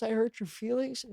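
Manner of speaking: high-pitched mock wail of hurt feelings; imitated bawling. Mom crying over anything, imitated high-pitched bawling. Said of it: "Just bawling".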